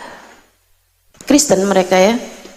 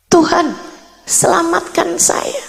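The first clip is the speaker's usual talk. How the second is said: high, voiced plea to God; retold pleading prayer; 5 semitones higher than usual. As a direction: pray imploringly, in a raised pitch. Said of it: "Saya kemudian mulai berdoa". (doa memohon dengan nada tinggi)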